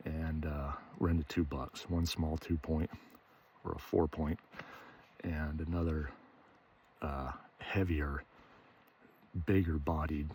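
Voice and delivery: soft, low hunting murmur; hushed voice. Real, not acted. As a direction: keep it hushed so the deer stay put. Hushed talk near deer.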